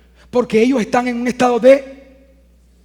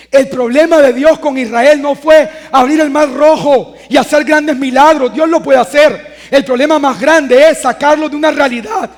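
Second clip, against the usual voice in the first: loud, raised preaching voice, driving each point home; full-force preaching; 3 semitones higher, 6 dB louder than usual. Acted.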